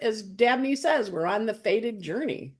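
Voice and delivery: ordinary talk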